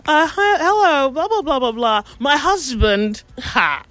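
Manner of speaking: giggly wife. Retold or imitated. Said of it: "and she says".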